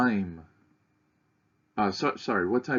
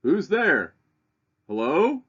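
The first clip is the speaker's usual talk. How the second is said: higher, louder calls, then a puzzled drop; calling out at the door; 8 semitones higher, 6 dB louder than usual. Acted. Voicing the resident in the poem, calling then puzzled. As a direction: call out from inside the house, then give up. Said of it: "eventually somebody responds".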